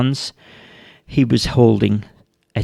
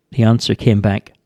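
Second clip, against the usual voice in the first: plain reading voice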